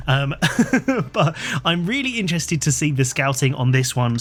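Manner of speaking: usual speaking voice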